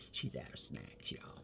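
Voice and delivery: a breathy whisper shared with viewers; whispered aside. Real, not acted. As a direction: let the audience in on a secret, whispering.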